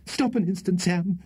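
high, breathless plea to stop; nervous skater's high gasp. A narrator's reading. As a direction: gasp it out, clinging on in alarm. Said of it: "gasped Mister Winkle".

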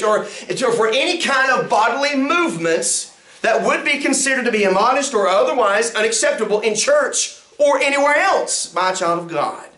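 ordinary talk

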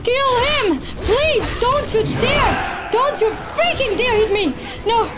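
high, frantic yelling; desperate pleading. Real, not acted. Player warning the boss off, frantic. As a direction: plead and warn in real panic.

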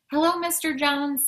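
high, loud hello to a friend; cheerful greeting. A narrator's reading. Hyacinth greets the family's postman at the door, cheerful greeting.

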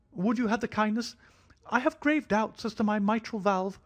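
a hypochondriac begging for reassurance; fretful thin high voice. A narrator's reading. Nervous host pleading with the doctor about his heart, thin and high.

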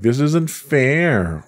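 put-on drawn-out, mildly whiny teen complaint. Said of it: "That was my whiny, whiny voice".

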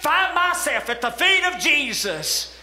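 reach for it with rising hope; Mary's hope voiced in a preaching swell; fervent longing.

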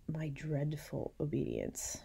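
hushed, slow whispered aside; a slow confiding whisper. Shown as a demonstration. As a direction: breathe the phrase out slowly. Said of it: "She calls it her".

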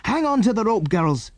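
put-on high, urgent call to the girls; urgent cry. A narrator's reading. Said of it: "cried Andy".

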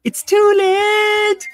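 high, slow sing-song; high drawn-out. Retold or imitated. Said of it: "Colin, we're like".

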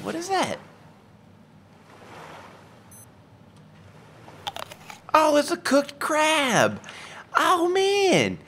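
very high, wondering creature voice; high curious character voice. Pretend. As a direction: gasp at it like a cartoon.

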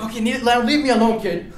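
imitating a beaten thief; exasperated give-up. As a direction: wave him off wearily.

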